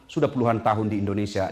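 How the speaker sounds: ordinary delivery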